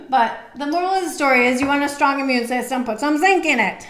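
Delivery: loud, high, fast punchline; emphatic declaration. Real, not acted. Covering an on-camera blank, loud exaggerated summing-up. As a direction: belt out the takeaway.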